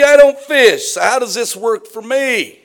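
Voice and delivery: high, loud protesting listener; mock objection.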